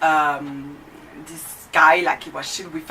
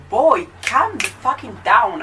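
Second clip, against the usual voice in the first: higher, louder, slowed-down outburst; exasperated retort; 5 semitones higher, 6 dB louder, slower than usual. Retold or imitated. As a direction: tell him to calm down, drawing it out. Own retort to the teacher.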